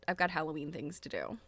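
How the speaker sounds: usual speaking voice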